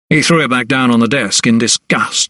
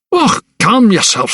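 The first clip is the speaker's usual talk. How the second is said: higher, indignant calm-down; indignant brush-off; 9 semitones higher than usual. A narrator's reading. Principal rebuking the agitated doctor, indignant. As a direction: wave off the young man's outburst. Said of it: "He seemed indignant rather than frightened".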